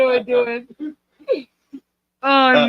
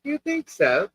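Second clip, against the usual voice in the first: soft, higher-pitched English accent; 5 semitones higher, 6 dB quieter, slower than usual. Shown as a demonstration. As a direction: demonstrate a light English accent. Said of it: "it's when I say".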